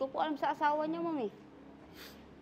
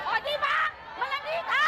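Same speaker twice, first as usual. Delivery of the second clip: angry shouting; 8 semitones higher than usual. Real, not acted. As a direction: shout it at her. Wife shouts insult.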